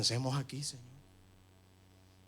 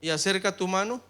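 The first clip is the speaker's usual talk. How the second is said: raised Bible reading, voicing Jesus lightly; scripture reading; 3 semitones higher, 6 dB louder than usual. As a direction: read the verse out to the church. Reads scripture aloud.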